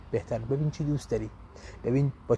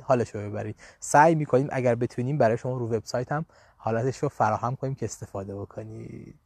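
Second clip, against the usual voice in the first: normal talking voice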